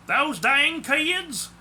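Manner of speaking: drawn-out, high Southern grumble; high-pitched Southern holler, vowels stretched; thick Southern accent. A narrator's reading. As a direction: complain in a slow, thick Southern drawl. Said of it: "in a thick Southern accent".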